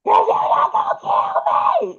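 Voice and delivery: very high, strained re-enacted scream; mock screaming fit, drawn out; screaming and hollering. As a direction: act out a hysterical high-pitched holler. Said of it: "I was screaming and hollering so much".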